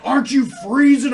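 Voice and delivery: high, loud amazed question; retold amazed question. Trucker asking in the cold, in loud disbelief. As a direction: gasp it out in disbelief. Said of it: "he's like".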